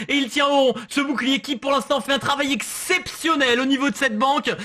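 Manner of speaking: rising hyped play-by-play; esports caster. Real, not acted. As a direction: call the action as it builds. Live play-by-play of the round.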